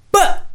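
sudden loud "bah" for a blow; imitated blow. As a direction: mimic a heavy hit with one short burst. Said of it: "And he just went".

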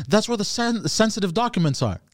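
high-pitched, indignant retelling; retold anger. Friend retelling his dream argument about the phone, mildly indignant. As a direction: protest in mildly mock outrage. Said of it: "So I start getting mad at him".

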